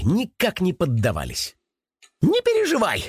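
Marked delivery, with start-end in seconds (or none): 2.2-3.0 high-pitched eager rabbit voice; rabbit chiming in. A narrator's reading. Rabbit backing up the hedgehog's comfort, chiming in high. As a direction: chime in high, like a little rabbit. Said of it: "поддакнул кролик".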